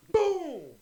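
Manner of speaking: shouted explosion sound; mimicking the car blowing up; loud boom. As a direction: yell the blast.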